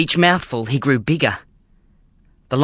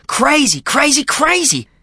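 panic building to high cries; 12 semitones higher than usual. A narrator's reading. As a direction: voice climbing higher with panic. Panic builds, repeated word.

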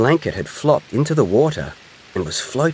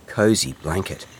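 ordinary delivery, nothing marked

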